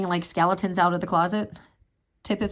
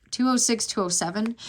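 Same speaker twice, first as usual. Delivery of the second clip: ordinary delivery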